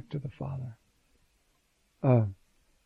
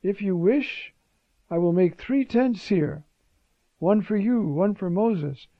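eager, louder and higher, blurting out his offer; enthusiastic Peter; 4 semitones higher, 6 dB louder than usual. Retold or imitated. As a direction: Peter carried away by joy. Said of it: "he's enthusiastic".

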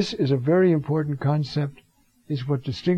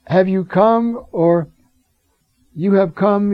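louder, higher accusing cry; 4 semitones higher, 5 dB louder, slower than usual. Acted. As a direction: hurl the question at the intruder. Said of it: "cries out".

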